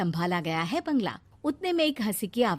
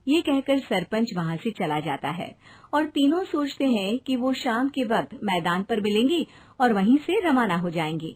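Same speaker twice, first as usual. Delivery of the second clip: ordinary delivery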